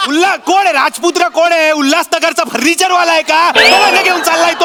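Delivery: high, loud scolding demand; angry berating. Real, not acted. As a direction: tear into him with loud questions. Director berating actor.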